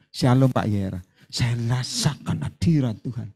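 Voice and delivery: low, slow, pious voice; pious mock greeting. False prophet greeting the pastor, low pious voice. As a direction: fake holiness, slow and low.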